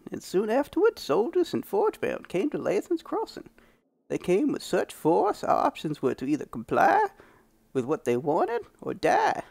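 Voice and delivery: put-on high, grizzled old prospector; old man prospector voice. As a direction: play a crusty old prospector. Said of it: "doing a freaking old man prospector voice".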